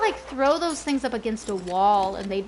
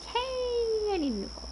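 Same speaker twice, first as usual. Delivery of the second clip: mock viewer voice; 11 semitones higher, 4 dB quieter than usual. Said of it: "you're like".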